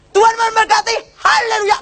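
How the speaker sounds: high, loud imitated revival shout; Gilbert blessing. Preacher blesses as spell arrives, high shouted blessing. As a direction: proclaim it at the top of the voice. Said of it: "langsung Gilbert gini".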